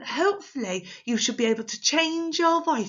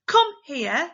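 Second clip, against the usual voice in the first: loud, raised-pitch summons; bossy command; 6 semitones higher, 7 dB louder than usual. Shown as a demonstration. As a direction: call someone over bossily. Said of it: "changed my voice for those, for those commands".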